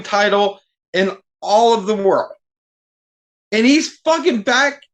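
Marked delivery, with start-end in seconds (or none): 3.5-4.8 high-pitched, exasperated rant; venting disbelief, pitch climbing. Real, not acted. Fan ranting about a wrestler's promo, high and exasperated.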